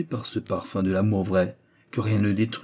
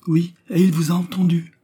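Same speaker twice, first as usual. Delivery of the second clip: high, louder, ringing declaration; vibrant voice; 9 semitones higher, 6 dB louder than usual. A narrator's reading. Young man revealing himself from behind, in a vibrant voice. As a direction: break in with a vibrant, raised voice. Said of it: "répéta derrière une voix vibrante".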